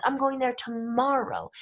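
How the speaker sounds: "tomorrow" picked out by intonation; emphasize "tomorrow" to correct a wrong assumption; stress on tomorrow. Shown as a demonstration. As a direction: land firmly on "tomorrow". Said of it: "We do it with our intonation".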